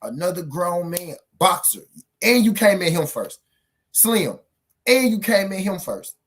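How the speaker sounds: pressing the point hard; heated rant. Real, not acted. Vlogger ranting at Teofimo over who started it.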